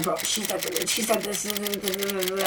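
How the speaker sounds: normal talking voice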